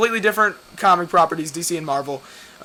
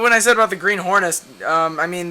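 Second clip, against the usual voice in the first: ordinary delivery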